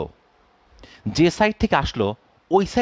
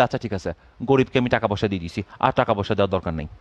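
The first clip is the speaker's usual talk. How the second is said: voicing the Earth; 8 semitones lower, faster than usual. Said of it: "তখন পৃথিবী বলবো যে".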